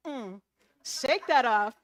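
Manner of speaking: sassy shout. Retold or imitated. Sassy self-talk, shaking it off.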